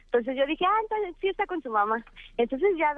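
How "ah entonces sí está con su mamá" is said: sarcastic. Retold or imitated. Her sarcastic retold thought.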